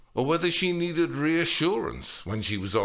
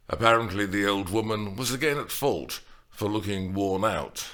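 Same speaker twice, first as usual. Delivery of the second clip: usual speaking voice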